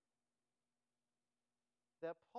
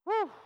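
loud, high whew; mock-overwhelmed puff of air; exaggerated exhale; 18 dB louder than usual. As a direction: blow out a big overwhelmed whew. Overwhelmed by Paul's long list.

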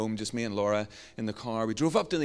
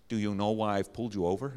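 put-on low, awful American trooper accent; bad American accent; 3 semitones lower, faster than usual. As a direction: attempt a clumsy American accent. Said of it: "That's an awful American accent".

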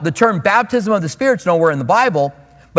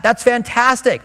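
ordinary talk, nothing marked

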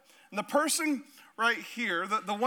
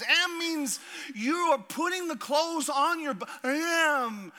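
put-on higher voice, kid insisting I am; parent and kid back-and-forth; 5 semitones higher than usual. Parent waking kids, trading lines with child. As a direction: play both nagging parent and stubborn kid.

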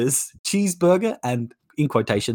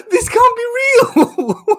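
high, laughing near tears; cry-laughing at the news; laughing in disbelief; 4 dB louder, slower than usual. Real, not acted.

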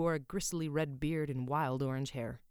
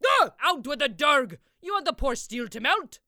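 higher, loud exasperated smith; angry blacksmith; 10 semitones higher, 12 dB louder than usual. A narrator's reading. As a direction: yell at the dawdling boy. Blacksmith yells at boy.

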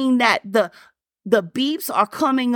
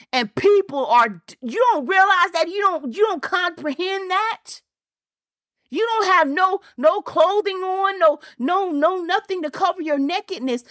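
preaching; 5 semitones higher than usual. Acted. Preaching climax.

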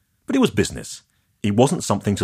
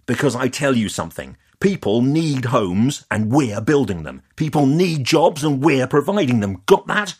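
loud, higher furious rant; blood up; 7 semitones higher, 4 dB louder than usual. Acted. Developer ranting at Leah about homes and jobs, loud and furious. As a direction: pound each phrase at her in anger. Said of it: "My blood was really up now".

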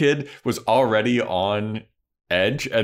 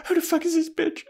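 high-pitched mock indignation; squeaky, hammed-up jealous outburst; jealous girlfriend; faster than usual. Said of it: "she sees Angel talking to Drusilla".

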